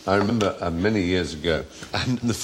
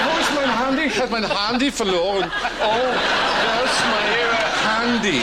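high camp German panic over a lost phone; 9 semitones higher than usual. Pretend. Mocking German speakers, high camp panic over a lost Handy. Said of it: "so typically camp".

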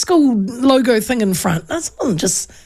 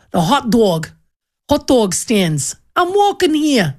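put-on New York accent; 3 semitones higher than usual. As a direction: play at a brash New Yorker accent. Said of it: "that's my New York".

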